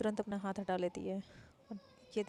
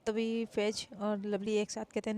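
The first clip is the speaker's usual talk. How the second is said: usual speaking voice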